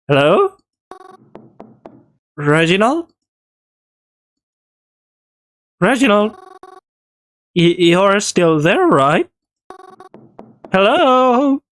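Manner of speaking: calling out into the dark, uneasy; nervous calling. Acted. Calling for Reginald in darkness.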